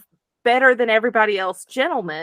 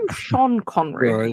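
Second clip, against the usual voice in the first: low mock Scottish actor voice; softly put-on Scottish burr, pitched down; Sean Connery; 4 semitones lower, 5 dB quieter than usual. Joking he sounds Scottish no matter what, low impression. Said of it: "Scottish no matter what".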